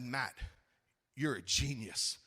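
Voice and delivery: low, soft deadpan admiration; retold solemn praise. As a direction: drop low and quiet, as if awed. Solemn retold praise of friend's idea.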